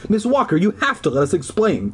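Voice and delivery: a touch of shrill panic; cried shrilly. A narrator's reading. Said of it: "I cried shrilly".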